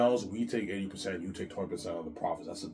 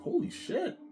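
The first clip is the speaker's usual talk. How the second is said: squeaky awed exclamation, much higher; voicing Peter; slower than usual. Peter stunned by the deal, squeaked high. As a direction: squeal it out in shock. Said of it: "He's like".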